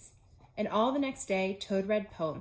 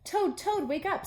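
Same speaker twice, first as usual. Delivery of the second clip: calling out; 10 semitones higher, 4 dB louder than usual. Acted. Calling to wake someone.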